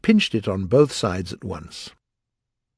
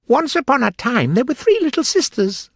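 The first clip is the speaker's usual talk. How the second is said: high, loud, squeaky storyteller; hurried Dormouse; 5 dB louder than usual. A narrator's reading. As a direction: launch in urgently as a little dormouse. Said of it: "began in a great hurry".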